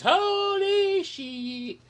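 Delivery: very high, stretched-out mock shriek; mock terror. A visitor facing Godzilla spiders, with a high drawn-out mock shriek. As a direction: scream like a visitor seeing giant spiders.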